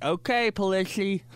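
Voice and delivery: mock-lisping "Okay, Pelisi". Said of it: "she had a lisp".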